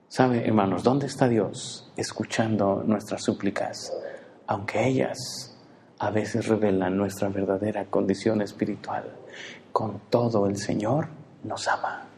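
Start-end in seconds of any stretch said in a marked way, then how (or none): none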